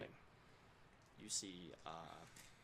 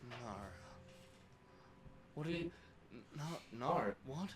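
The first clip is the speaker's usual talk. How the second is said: bewildered; 3 semitones higher, 4 dB quieter than usual. Acted. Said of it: "at first it's bewilderment, confusion".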